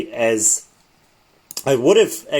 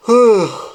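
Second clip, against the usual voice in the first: tired sigh; 8 semitones higher, 6 dB louder than usual. Real, not acted. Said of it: "sorry about that, guys".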